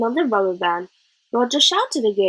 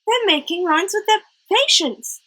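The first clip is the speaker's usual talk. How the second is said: high and eager; 9 semitones higher than usual. A narrator's reading. Roger eagerly telling the teacher about pencils, high and bright. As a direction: share it like a thrilling discovery. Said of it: "Roger said eagerly".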